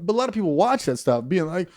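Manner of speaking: ordinary delivery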